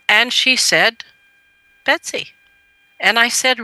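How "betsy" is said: put-on little girl. Mother voices three-year-old daughter.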